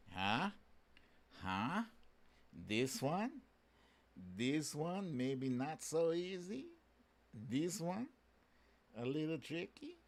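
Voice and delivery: soft, sly teasing to the audience; quiet playful taunt. Real, not acted. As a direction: coax them like a little riddle. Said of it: "teasing the viewers with a puzzle".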